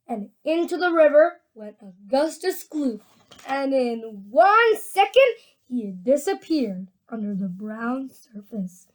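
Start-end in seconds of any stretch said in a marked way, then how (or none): none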